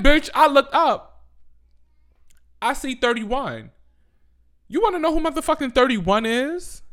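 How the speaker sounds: building outrage at the ranking; outraged rant. Real, not acted. Ranting to viewers about Ebro's list, outraged shout.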